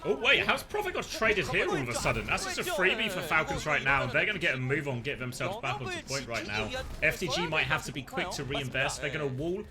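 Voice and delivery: ordinary talk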